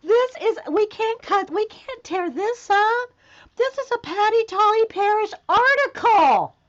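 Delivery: high, loud, emphatic protest; excited near-yell. Real, not acted. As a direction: insist loudly in thrilled disbelief. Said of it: "sorry, I'm yelling at you".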